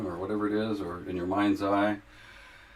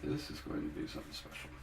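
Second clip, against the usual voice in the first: whispering; 7 semitones higher, 11 dB quieter than usual. Real, not acted. Excited about the painting.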